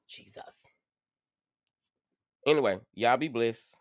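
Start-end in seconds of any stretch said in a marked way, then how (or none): 0.1-0.7 breathy whispered Jesus, worn out; whispered exhale. Real, not acted. Relief after paying bill.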